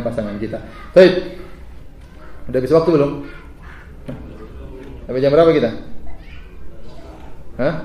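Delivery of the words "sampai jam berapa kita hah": usual speaking voice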